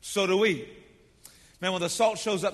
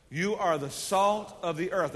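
slow group recital; slower than usual. Shown as a demonstration. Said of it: "Let's say this all together".

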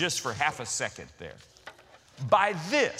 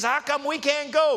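high, louder whiny objection; childlike protest; 9 semitones higher, 5 dB louder than usual. Retold or imitated. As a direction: play Peter as a kid left out.

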